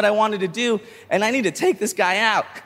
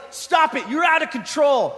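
high shouted referee warning; high-pitched referee; 7 semitones higher than usual. Retold or imitated. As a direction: play the alarmed referee, high. Referee quoted.

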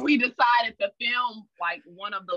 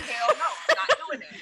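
laughing refusal; 8 semitones higher than usual. Retold or imitated.

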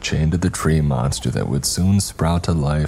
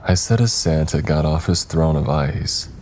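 ordinary delivery

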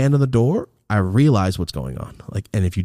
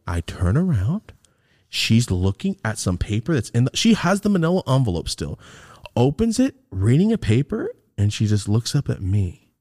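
ordinary delivery

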